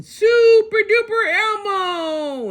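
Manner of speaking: high, slow triumphant yell. A narrator's reading. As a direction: shout it mid-leap like a superhero.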